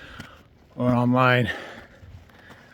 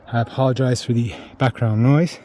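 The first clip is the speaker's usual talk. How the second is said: ordinary talk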